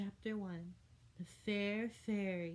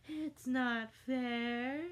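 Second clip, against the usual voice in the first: whiny complaint; 6 semitones higher, 5 dB louder, much slower than usual. A narrator's reading. Drawn-out whine.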